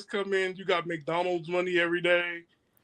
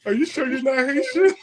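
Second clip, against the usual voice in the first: higher, louder repeat of the question; teasing repeat, pitched up; 7 semitones higher, 4 dB louder than usual. Real, not acted. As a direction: say it again up high and louder, as if not heard.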